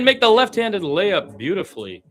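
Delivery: ordinary delivery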